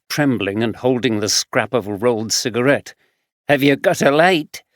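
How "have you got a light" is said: lightly voiced rough Glaswegian man; gruff Glaswegian drifter answering; Glaswegian accent. Fraser, Glaswegian homeless man.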